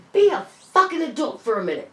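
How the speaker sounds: high, loud exasperated order; angry demand. Retold or imitated. Scolding her boyfriend in the waiting room, loud and angry. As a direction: snap him into line.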